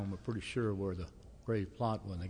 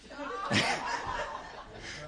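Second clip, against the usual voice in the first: breathy high laugh. Real, not acted. Congregation laughter moment.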